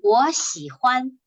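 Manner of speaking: slow, clearly spaced 我喜欢; slow model repetition. Shown as a demonstration. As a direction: say 我喜欢 slowly, syllable by syllable. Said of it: "You keep trying to say this".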